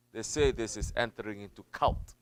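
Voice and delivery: ordinary talk, nothing marked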